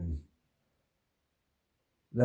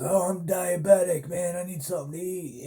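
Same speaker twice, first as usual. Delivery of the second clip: higher, pleading mock drunk; drunk guy claiming; 9 semitones higher than usual. Drunk inmate pleads with the cops for food, higher mock whine. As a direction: fake a sickness to get fed. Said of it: "finally he's".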